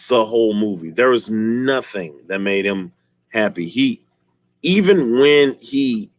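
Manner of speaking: normal talking voice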